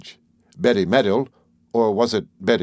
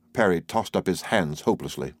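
ordinary delivery

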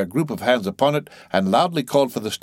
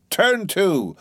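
roaring captain; 10 semitones higher than usual. A narrator's reading. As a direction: roar the command to get back to work. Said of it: "roared the captain".

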